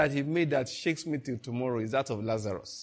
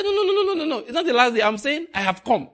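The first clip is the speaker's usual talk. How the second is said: high, loud laughing no, no, no; Jesus correcting Martha with joy; excited shout; 9 semitones higher, 5 dB louder, faster than usual. Retold or imitated. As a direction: cry it out high and delighted. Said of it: "He said".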